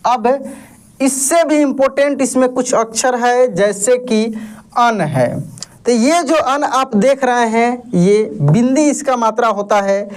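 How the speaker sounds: ordinary talk, nothing marked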